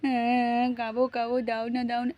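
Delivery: soft pleading mock cry; pretend baby crying. Baby begging Mishtu to hand it over, pretend crying. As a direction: plead in a small fake whimper. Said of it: "তোমার baby কাঁদছে কেন".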